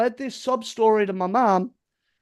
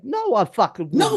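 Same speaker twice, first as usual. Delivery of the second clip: indignant refusal; 6 semitones higher, 3 dB louder than usual. Retold or imitated. Retold indignant refusal to girlfriend.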